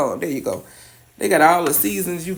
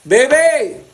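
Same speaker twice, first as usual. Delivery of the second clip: excited call; 9 semitones higher, 6 dB louder than usual. Real, not acted. Calling out to viewers.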